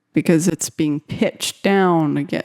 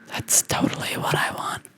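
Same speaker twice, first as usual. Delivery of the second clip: breathy delighted whisper; 8 dB quieter than usual. Real, not acted. Finding the perfect air horn sample, delighted whisper. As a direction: whisper it like a secret thrill.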